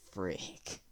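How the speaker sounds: a breathless, voiceless little frick; muttered curse. Retold or imitated. Caught by the teacher, a voiceless muttered frick. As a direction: mutter it under your breath, defeated.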